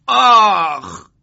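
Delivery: sharp drawn-out cry of pain, high and loud. Acted. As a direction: yelp as someone lands on you. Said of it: "صدای فریاد مرادی برخاست".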